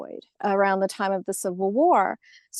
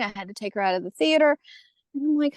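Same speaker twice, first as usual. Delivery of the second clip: ordinary talk, nothing marked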